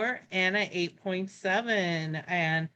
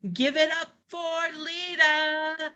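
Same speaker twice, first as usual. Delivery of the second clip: announcer's call; 8 semitones higher than usual. Real, not acted. Host bringing the next poet to the mic, announcer's call. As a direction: send the name ringing up to the stage.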